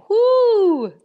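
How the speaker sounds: high shouted whoo; loud whoo. Real, not acted. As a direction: let out a big whoo. Reacting to strong hit.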